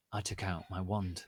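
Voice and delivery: plain reading voice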